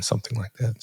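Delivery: ordinary talk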